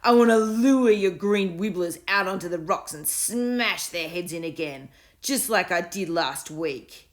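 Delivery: loud, slow, gloating bully voice; boastful bully. A narrator's reading. Bully taunting Will about his wibblers, gloating loudly. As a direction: gloat over the win.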